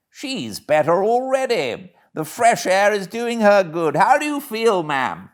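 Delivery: a hint of a concerned gentleman; kindly gentleman. Gentleman voiced in story.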